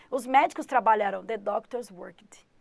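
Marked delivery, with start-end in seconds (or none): 1.2-2.5 whisper. Real, not acted. Whispered example sentence.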